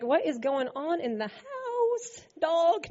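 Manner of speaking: casual slangy dude voice, lightly done; a hint of slangy swagger; mock street slang. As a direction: toss it off like a street kid calling his buddy dog. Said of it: "That's not how I talk".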